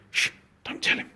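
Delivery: conspiratorial whispered aside; playful stage whisper. Pretend. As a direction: hush it like sharing a secret. Secret aside to viewers.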